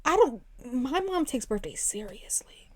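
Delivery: slow, soft near-whisper; hushed. Real, not acted. Daughter confiding mom takes birthdays seriously, hushed. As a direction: drop to a whisper for gravity.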